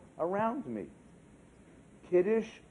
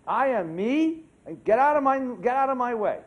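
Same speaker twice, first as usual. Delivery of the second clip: defiant me-first; 5 semitones higher, 8 dB louder than usual. Retold or imitated. Mocking American individualist attitude.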